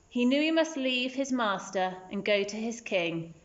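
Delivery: ordinary delivery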